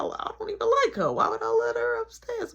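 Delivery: high, whiny protest; mock the friend's high indignant whine; squeaky defensive friend.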